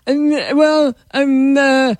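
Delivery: Napoleon Dynamite-like dim drone; dopey boyfriend. Acted. Boyfriend dithering about dinner, dopey drawl. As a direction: groan it out, vague and undecided. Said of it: "he's always like".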